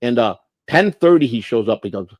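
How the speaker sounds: usual speaking voice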